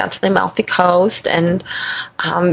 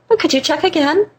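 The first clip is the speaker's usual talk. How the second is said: high, imploring request; sweet pleading; 9 semitones higher than usual. Retold or imitated. Guest retelling her plea to innkeeper, high and coaxing.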